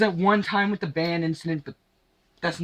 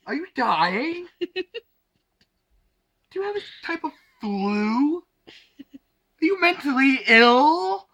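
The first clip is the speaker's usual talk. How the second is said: mock panic; 11 semitones higher, 4 dB louder than usual. Panicked reply to screaming baby.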